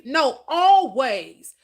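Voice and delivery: ALWAYS drawn out slow and loud; emphatic always. Acted. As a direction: lean hard on always, slowly. Emphatic correction of sometimes.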